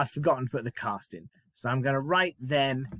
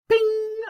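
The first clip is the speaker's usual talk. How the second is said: high, ringing "bing"; ping it out loud and very high; bright-idea bell sound; idea chime; 6 dB louder than usual. Shown as a demonstration. Imitates a sudden idea chime.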